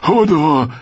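high, loud cry of the stableboy; lautes Rufen. A narrator's reading. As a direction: call out high, voice falling at the end. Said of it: "rief Hodor wieder". (lautes, hohes Rufen)

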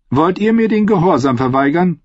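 high, commanding noblewoman; lightly voiced scheming queen. A narrator's reading.

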